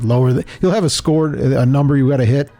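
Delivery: normal talking voice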